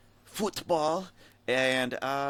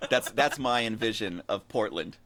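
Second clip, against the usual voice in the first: ordinary talk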